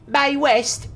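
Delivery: high-pitched mock Cockney. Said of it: "I am a Cockney through and through".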